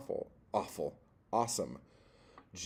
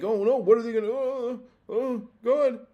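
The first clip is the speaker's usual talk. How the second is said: mock panic; 13 semitones higher, 8 dB louder than usual. Mocking publishers' panic.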